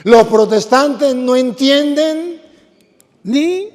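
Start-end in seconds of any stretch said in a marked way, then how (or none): 0.0-2.4 loud, slow, every word stressed so the congregation repeats it; preacher leading a repeat-after-me; slow emphatic dictation. Shown as a demonstration. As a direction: drill "Los protestantes no entienden" loud and slow. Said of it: "Otra vez".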